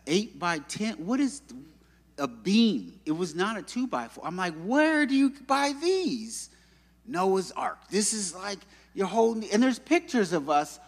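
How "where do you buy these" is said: high, drawn-out incredulous question; mock amazement. Helper staring at huge beams, mock incredulous. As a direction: stretch it out high, comically astonished.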